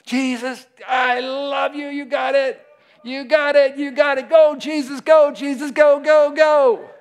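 high, loud shouted cheering; ecstatic cheer. Pretend. Practising abandon in prayer, loud high cheering. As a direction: cheer him on like a fan.

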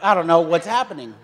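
ordinary delivery

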